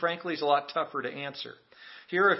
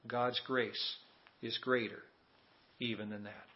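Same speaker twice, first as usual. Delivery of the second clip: hushed assurance; 5 semitones lower, 9 dB quieter than usual. Real, not acted. Quiet close of a grace point.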